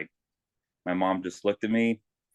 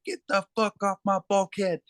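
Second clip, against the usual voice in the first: retold yelling instructor; 11 semitones higher than usual. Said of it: "starts yelling at me".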